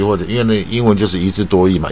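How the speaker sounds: ordinary delivery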